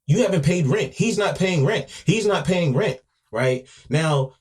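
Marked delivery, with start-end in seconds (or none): 0.1-3.0 high, loud mock yelling; raised, high-pitched retold outcry; retold screaming. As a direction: imitate an angry woman yelling out complaints. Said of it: "she was screaming out in Cantonese".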